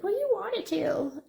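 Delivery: high mock mom voice; mimicking mom. Recalling her mom about the cut blanket, high mock voice. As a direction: play mom, up high and dismissive. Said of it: "My mom was always like".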